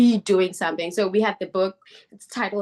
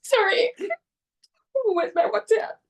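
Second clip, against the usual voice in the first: much higher, voice breaking into tears; crying; 14 semitones higher, slower than usual. Real, not acted. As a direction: apologise while crying for real. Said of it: "ooh, I'm gonna get emotional".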